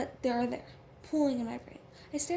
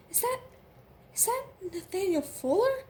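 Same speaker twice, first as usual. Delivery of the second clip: high, hard-edged questioning; edged with steel; 8 semitones higher, 5 dB louder than usual. A narrator's reading. Girl learning who is behind the door, edged with steel. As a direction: raise the pitch sharply in steely disbelief. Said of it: "Her voice is edged with steel".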